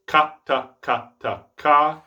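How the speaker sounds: separate, even "ka, ta" alternation; firm K leading each "ka, ta" pair; steady alternating "ka, ta", ending on "ka"; reversed double tongue. Shown as a demonstration. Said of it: "have all of these sound the same".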